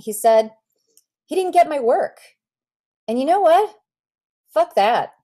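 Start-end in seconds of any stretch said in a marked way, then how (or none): 1.3-5.1 higher, louder, swaggering defiance; a brash, defiant flare-up; defiant outburst. Acted. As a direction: spit it out like a proud rebel. Defiant artist rejecting his teacher.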